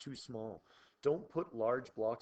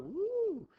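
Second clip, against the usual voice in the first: high, loud, hammed-up cheer; mock whoop; 8 dB louder than usual. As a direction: whoop like a kid excited by flashy slide effects. Mock cheer at fun slide effects.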